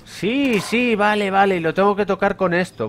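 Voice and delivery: high-pitched eager yes, got it; suddenly gets it, voice jumps up; excited realization. Real, not acted. Excited realization solving puzzle.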